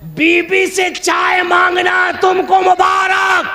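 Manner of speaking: high, slow, drawn-out declamation; ringing shout. Acted. Preacher handing wife's tea to the opponent, high ringing shout. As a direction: call it out high and stretched. Punchline contrast, first half shouted.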